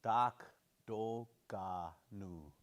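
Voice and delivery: slow each syllable of Do Ga Nu. Shown as a demonstration. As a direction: Do Ga Nu spelled out slowly, G clearly voiced. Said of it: "And a G right here".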